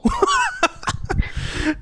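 high burst of laughter. Real, not acted. Host cracking up at his own "I don't know", high laugh. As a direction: laugh at your own bit.

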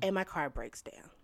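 hushed dramatic whisper. Real, not acted. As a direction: drop to a whisper for effect.

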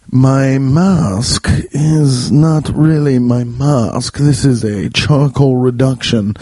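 put-on very slow, drawn-out old man. Raccoon explaining his mask, slow old man voice. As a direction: stretch the words out like a doddering old man. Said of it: "talking like an old man".

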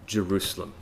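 very slow, soft and intimate; slow hushed heartfelt plea; tender, heartfelt. Shown as a demonstration. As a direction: let each word sink in gently. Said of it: "Getting to their heart".

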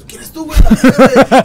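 high mock challenge, laughing; barking drunk challenge. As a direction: bark at the mirror like a dog. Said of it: "Como un perro viéndose".

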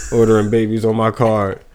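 usual speaking voice

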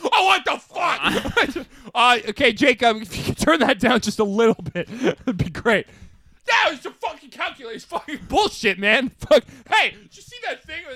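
a lightly voiced, excitable high version of his friend; high-pitched mock rant about calculus class; impression of friend. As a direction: blurt it out high and wild like him. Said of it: "I'm just doing an impression of me as you".

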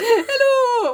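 high-pitched, muddled mock Irish; dubious Irish accent. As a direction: giggle through a badly faked Irish accent. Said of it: "What kind of accent's that?".

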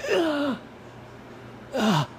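high strained "ah" of pain; imitated pained cry. Host showing their spine nearly popping out, mock pain. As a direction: re-enact short, high yelps. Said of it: "like that".

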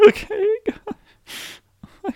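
very high, slow, wailing fake cry; mock crying. Pretending to cry at his buddy, high slow wail. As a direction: wail it like a hammed-up sob.

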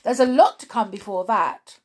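loud, insistent objection; hasty interruption. A narrator's reading. Rabbit hastily stopping the king's verdict, loud insistence. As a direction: press the objection loudly. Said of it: "the rabbit hastily interrupted".

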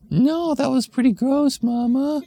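put-on high, whiny disgusted kid; child voice. Kid telling mom the smell was gross, high squeal.

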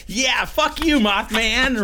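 high, loud jeering yell; teasing shout at Mothman while rubbing his wings; mock taunting yell. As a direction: jeer at him like a gleeful brat.

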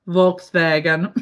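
"Volkswagen" said slowly, as in German; German pronunciation. Shown as a demonstration. Showing off her few German words, "Volkswagen" pronounced German-style. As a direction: say "Volkswagen" with deliberate German sounds. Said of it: "can hear me speak German".

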